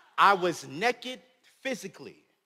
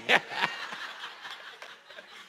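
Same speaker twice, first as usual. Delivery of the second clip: breathy laugh; 3 dB quieter than usual. Real, not acted. Laughs after line 89.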